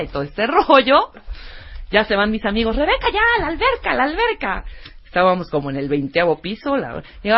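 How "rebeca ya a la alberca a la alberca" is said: high, quick call of friends urging her to the pool. Retold or imitated. Friends urging her to come to the pool, called out high and fast. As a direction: mimic eager friends calling her name.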